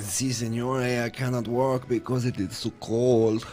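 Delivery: slow, soft mock Mexican accent. Co-host doing a requested impression, slow mock Mexican accent. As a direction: drag it out in a put-on Mexican accent. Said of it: "you do an impression there of, uh, an, a Mexican".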